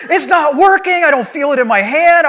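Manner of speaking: high, loud urgent alarm; frightened shouting. Retold or imitated. Patient warning doctors he is still awake, panicked shout. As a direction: shout in panic that something's wrong. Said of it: "I was afraid".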